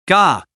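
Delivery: a sharp strained battle cry; piercing cry. Acted. The angel pushes back the charging beast, piercing cry. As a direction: cry out as power surges. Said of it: "let out a piercing cry".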